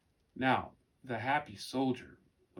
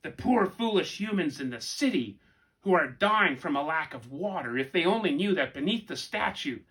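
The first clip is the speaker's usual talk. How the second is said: higher, louder mocking bird voice; gloating raven; 5 semitones higher, 6 dB louder than usual. A narrator's reading. Raven mocking humans from the tree, loud high gloat. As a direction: sneer down at the foolish humans like a raven.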